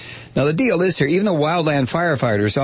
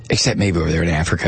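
usual speaking voice

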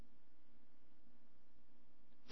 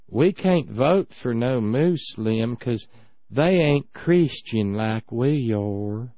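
very slow, lower country drawl; 4 semitones lower, much slower than usual. Retold or imitated. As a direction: drag out every word like a rural voter. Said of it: "Emory looks at Eileen and says".

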